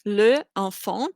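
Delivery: LE ENFANT as two separate words, both vowels sounded; uncontracted, no elision. Shown as a demonstration. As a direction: keep the vowel of "le", break, then "enfant". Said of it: "would actually be".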